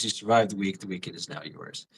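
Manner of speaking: words fading on short breath. Real, not acted. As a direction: talk while winded and spent. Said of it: "And I'm out of breath".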